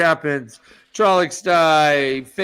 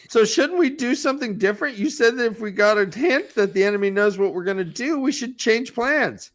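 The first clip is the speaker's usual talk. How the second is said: lightly voiced higher, earnest officer; quoted officer; 6 semitones higher than usual. A hint of the captain arguing with his general. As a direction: reason with him in a slightly raised put-on voice. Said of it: "He says, quote".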